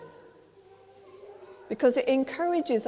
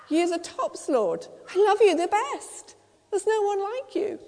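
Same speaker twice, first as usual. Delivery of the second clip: higher and louder, bursting with eagerness; eager devotion; 8 semitones higher, 4 dB louder than usual. Acted. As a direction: Peter gushing his love to the Lord. Peter eagerly answers Jesus.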